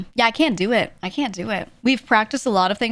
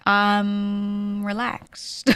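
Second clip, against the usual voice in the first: extremely drawn-out, level delivery; slow, lazy exaggerated reply; mock relaxed; 2 semitones higher, much slower than usual. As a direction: stretch it out as if too relaxed to bother. Acted reply to low-energy remark.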